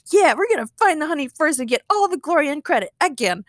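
high, gleeful boast; beaming, gloating. Acted. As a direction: relish the coming glory. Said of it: "Chad beamed".

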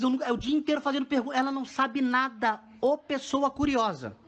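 usual speaking voice

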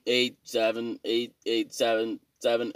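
slow, deliberate number-by-number; slow keypad counting. Shown as a demonstration. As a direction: tap it out key by key, slowly. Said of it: "you gotta hit, like".

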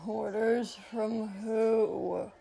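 slow, drawn-out hungover demand; whiny demand. A narrator's reading. Hungover prince questioning his servant, groggy demand. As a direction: groan the question out slowly. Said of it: "he demanded".

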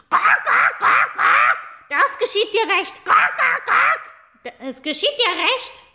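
very high, loud squawking bird voices; squawking hens. Acted. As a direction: squawk and jeer all at once.

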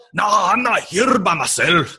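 hammed-up Irish accent; fake Irish accent. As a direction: ham up a drunk Irish voice. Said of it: "And he goes".

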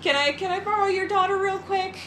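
high, sugary persuading voice; sweet pushy woman voice. Retold or imitated. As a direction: coax like a smooth talent agent. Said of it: "She was like".

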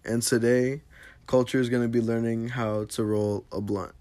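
loud, slow show opener; podcast host intro. Acted. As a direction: announce it like a host. Host opens the podcast loudly.

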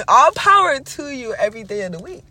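loud, high, emphatic send-off; amused cheering on. Real, not acted. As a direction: proclaim it big after a laugh. Laughing, grandly cheers the guru on.

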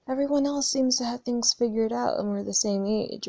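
normal talking voice

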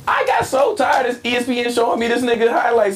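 high-pitched, exasperated outburst; fed-up rant. Real, not acted. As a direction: complain at a high pitch, sick of it. Fed-up rant about highlights.

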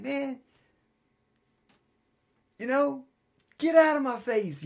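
high, loud, laughing mock scold; laughing mock telling off. Preacher laughing at his chewing dog, mock scold. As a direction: shoo the dog away through laughter. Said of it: "I'd start laughing and be like".

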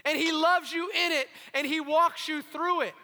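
loud, impassioned sermon delivery; full-force preaching. Acted. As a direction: preach it hard to the congregation.

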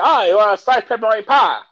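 loud, high mocking New Yorker; mock New York server. To the Kentucky visitor, a loud high mock New Yorker. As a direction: belt it out like a pushy pizza guy. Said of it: "I said".

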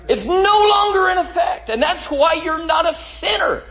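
high, ringing preacher's cry; preaching at full force. Acted. Preacher to congregation, crying out the victory high. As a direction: lift it up and proclaim it to the church.

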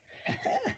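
airy high-pitched laugh; a squeaky delighted giggle; high breathy giggle. Real, not acted. Host cracks up at the chirp, high airy giggle.

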